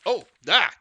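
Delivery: loud, high startled yelp; sudden real exclamation of surprise. Real, not acted. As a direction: cry out as if caught off guard. Startled by unexpected world map.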